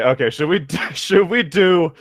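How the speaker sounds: normal talking voice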